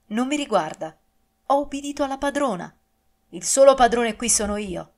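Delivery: angry master. Acted. Master angrily asserting his authority.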